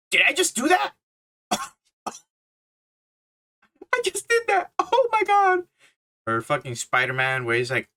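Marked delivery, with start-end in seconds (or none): 0.1-5.6 high, laughing disbelief at himself; real shock. Real, not acted. Reviewer stunned by his own noise, laughing high and amazed.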